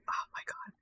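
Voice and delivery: hushed, breathless oh my god; whispered dismay. Retold or imitated. Gamer whispering at the timer mission, hushed dismay. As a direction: whisper it like quiet despair.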